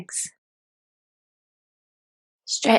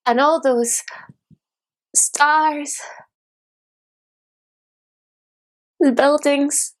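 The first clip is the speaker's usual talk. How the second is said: higher-pitched, mounting bitterness; bitter disillusion; 4 semitones higher than usual. Acted. Dreamer sees failed waitresses and sours, bitterly disillusioned. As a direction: let the disillusion push the voice up.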